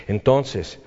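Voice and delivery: ordinary talk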